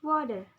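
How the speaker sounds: "Water" with WA hit loud; stress on first syllable. Shown as a demonstration. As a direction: punch the start of "Water", let the end drop. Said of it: "The stress at the beginning of a syllable".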